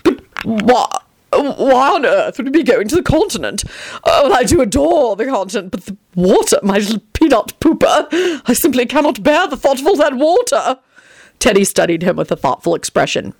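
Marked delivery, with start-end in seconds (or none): 0.0-10.8 distressed Tony. A narrator's reading. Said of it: "wrung his hands in distress".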